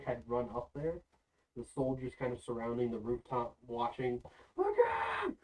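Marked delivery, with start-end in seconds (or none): 4.6-5.4 very high-pitched, loud shout; alarmed warning cry. Acted. Soldier warning others as succubi run up, alarmed high shout. Game master voices alarmed onlookers.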